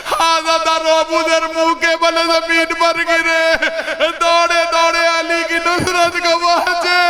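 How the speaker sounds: mourning preacher in full lament; majlis lament. Acted. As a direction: cry out the grief, stretching each phrase.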